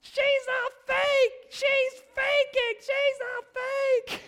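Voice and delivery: put-on very high, loud, drawn-out toddler yell; imitated toddler shouting. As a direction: yell it slowly like an upset little kid tattling. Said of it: "he's shouting in the living room".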